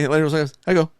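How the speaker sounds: ordinary talk, nothing marked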